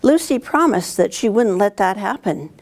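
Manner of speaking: plain reading voice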